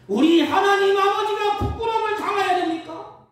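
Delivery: loud, high rhetorical questions; forceful pulpit challenge; challenging preaching. Acted. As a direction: fire each question at the congregation. Challenges congregation at full force.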